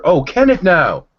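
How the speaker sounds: drawn-out mock surprise; sarcastic. Real, not acted. Sarcastic reply to woo claim.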